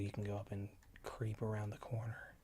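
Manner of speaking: hushed sneaky whisper. Real, not acted. Sneaking in game.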